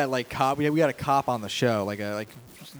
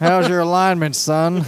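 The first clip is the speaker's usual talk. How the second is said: mock cop; 5 semitones higher, 8 dB louder than usual. Joining cop bit.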